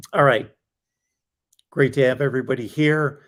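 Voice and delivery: worn-out, hoarse voice. Real, not acted. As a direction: voice starting to go hoarse. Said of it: "it's time when I'm losing my voice".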